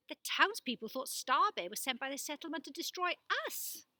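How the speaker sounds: exclaiming. A narrator's reading. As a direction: blurt it out in surprise. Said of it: "Alma exclaimed".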